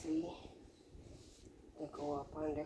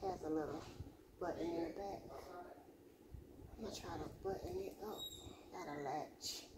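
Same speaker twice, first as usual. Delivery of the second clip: short of breath; 8 dB quieter, faster than usual. Real, not acted. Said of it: "I get short of breath".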